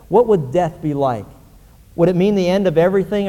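put-on reading an old letter aloud, earnest; story reading. A narrator's reading. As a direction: read the letter out to the church. Long story read aloud to congregation.

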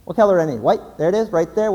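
ordinary delivery

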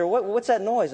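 a little worried. Retold or imitated. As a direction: imitate a boss growing uneasy. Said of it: "getting a little worried".

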